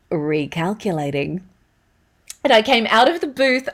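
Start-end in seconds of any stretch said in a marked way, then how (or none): 0.1-1.4 low, quiet, measured sat-nav word; GPS voice. Shown as a demonstration. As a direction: deliver it as the deadpan navigation prompt. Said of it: "everybody's favorite or not so favorite".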